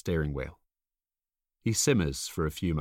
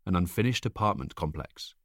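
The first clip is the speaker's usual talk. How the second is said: ordinary delivery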